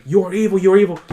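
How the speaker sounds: high, loud accusing outburst; imitated angry yelling. Imitating a son fighting with his dad, high angry yelling. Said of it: "he's yelling and fighting with his dad".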